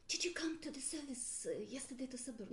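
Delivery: quick, breathy whispered question; hushed whisper. Retold or imitated. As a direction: lean in and whisper it fast like gossip. Churchgoer's hushed question after service.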